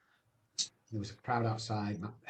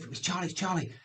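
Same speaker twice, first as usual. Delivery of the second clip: raised, urgent waking call; excited; 8 semitones higher, 3 dB louder, faster than usual. Retold or imitated. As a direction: blurt it out to wake someone. Waking wife at night, news.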